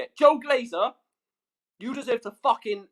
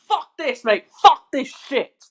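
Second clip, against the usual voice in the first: higher, much louder, slow furious swearing; furious shout; 4 semitones higher, 8 dB louder, slower than usual. Real, not acted. Streamer ranting about the Super League, furious slow shout. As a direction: let the rage out, drag it. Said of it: "I am fuming".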